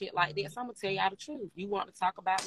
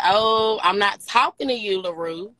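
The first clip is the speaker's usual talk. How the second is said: louder, snapping back at her; loud retort; 8 dB louder than usual. Retold or imitated. Retelling snapping back at LaRue in the hotel room, loud retort. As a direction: throw the line right back, loud. Retold clapback in argument.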